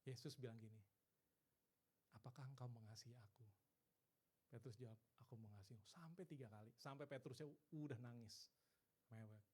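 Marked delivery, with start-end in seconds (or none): 2.2-3.5 soft, low, tender asking; gentle question. Acted. Jesus asking fallen Peter, soft and low. 5.2-5.8 very soft and low reply; subdued answer. Acted. Peter answering Jesus, subdued and very quiet.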